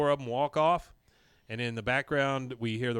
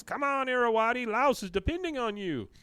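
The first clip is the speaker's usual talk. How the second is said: high-pitched plea from the stands; raised, urgent mock supporter; acted cheering; 11 semitones higher, 4 dB louder than usual. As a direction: call out urging from the audience. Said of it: "Khan out in the audience".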